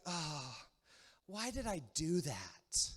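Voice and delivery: slow, soft rueful groan; regretful. Acted. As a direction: kick yourself after a fight. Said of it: "And then afterwards".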